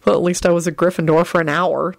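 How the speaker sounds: plain reading voice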